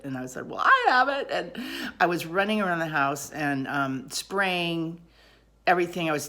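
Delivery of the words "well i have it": loud, high insistence; hammed-up indignant retort, pitch leaping up; acted protest. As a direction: insist over the other person, retelling an argument. Acted argument with Steve.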